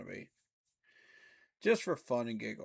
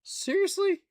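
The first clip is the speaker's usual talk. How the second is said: much higher and louder in disbelief; incredulous; 7 dB louder than usual. Real, not acted. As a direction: shriek it, can't believe it. Reacting to his team's rank.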